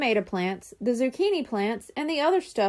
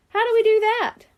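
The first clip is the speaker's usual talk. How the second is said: put-on high, louder eager kid; a curious boy eager to hear the plan; eager question; 10 semitones higher, 5 dB louder than usual. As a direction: ask it all keen. Brian asks eagerly.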